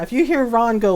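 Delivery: ordinary delivery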